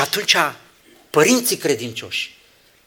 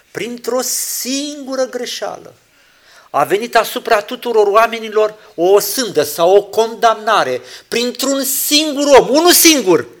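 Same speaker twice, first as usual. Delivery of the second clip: higher, louder preaching; a preacher stressing that one man brought sin; rising sermon emphasis; 8 semitones higher, 8 dB louder than usual. Acted. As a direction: drive the point home.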